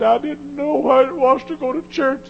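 high, loud anguished testimony; testifying young man. Retold or imitated. Preacher voicing a young man confessing, high and anguished. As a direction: cry it out like a broken young man.

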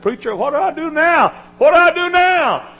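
high, loud, anguished plea; a single mother begging her preacher for help; pleading widow. Retold or imitated. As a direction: cry out for direction.

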